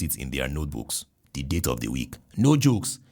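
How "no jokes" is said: usual speaking voice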